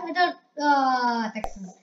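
ordinary talk

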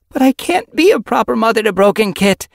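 high, loud, anguished plea; wretched. Acted. Yellow Fang despairs to Silver Flame over Broken Kit, wretchedly. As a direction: cry out your helplessness. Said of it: "meowed wretchedly".

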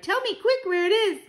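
put-on very high excited child's demand; urgent cry. A narrator's reading. Grandchild demanding the location from Grandmama, urgent cry. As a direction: plead high, bursting to know. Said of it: "I cried".

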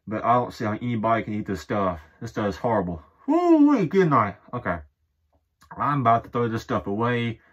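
muffled through cloth; through shirt over mouth. Real, not acted. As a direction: hand covering mouth. Seen 4: Shirt pulled over nose and mouth, hand pressing it, throughout.

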